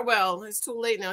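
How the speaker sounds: usual speaking voice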